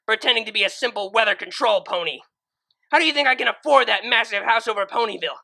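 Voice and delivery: high, loud furious screaming; seething scream. Acted. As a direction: scream back with pure hate. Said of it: "screamed in return".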